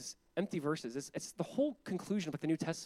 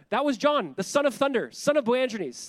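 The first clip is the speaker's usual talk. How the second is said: excited exclaim; 7 semitones higher, 7 dB louder than usual. Real, not acted. Preacher exclaiming.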